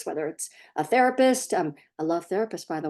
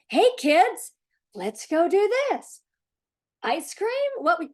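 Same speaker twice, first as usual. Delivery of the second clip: cheerful parent redirect; 9 semitones higher, slower than usual. Acted. Parent redirecting fighting kids.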